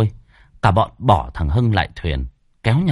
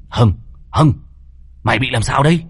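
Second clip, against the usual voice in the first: louder, urgent shaking call; alarmed calling; 2 semitones higher, 3 dB louder than usual. Acted. Toàn shakes the maimed Hưng, alarmed call. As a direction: call his name to snap him out of it.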